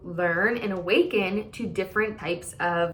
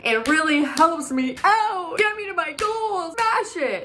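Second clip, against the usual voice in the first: rising into a high, pushy yell; hammed-up forceful plea, pitched way up; playfully aggressive hype shout; mock aggressive; 8 semitones higher than usual. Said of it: "That was aggressive".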